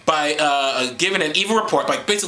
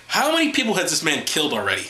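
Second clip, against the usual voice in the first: usual speaking voice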